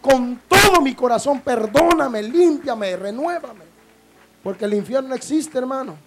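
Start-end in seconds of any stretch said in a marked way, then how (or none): 0.0-3.7 loud pleading altar call; fiery preaching. Acted. Pastor urging the congregation to repent, loud and fervent. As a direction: preach it out full force.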